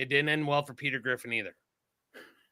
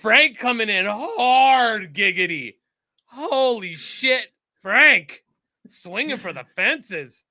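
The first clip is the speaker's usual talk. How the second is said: loud, drawn-out higher-pitched wow; loud astonishment; 5 semitones higher, 7 dB louder, much slower than usual. Real, not acted. Reacting to Frank coming in hard, loud drawn-out astonishment. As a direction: blown away, booming and stretching the words.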